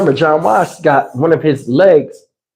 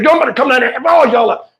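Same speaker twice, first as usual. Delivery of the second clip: fast, higher, heated threat; getting mad; 7 semitones higher, much faster than usual. Retold or imitated. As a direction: blow up at them over the phone. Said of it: "I'm starting to get mad".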